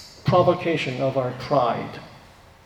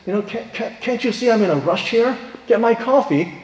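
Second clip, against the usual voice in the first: higher-pitched exasperated complaint; retold impatient demand, pitched up with a stammered start; upset; 6 semitones higher than usual. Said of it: "he was getting so upset".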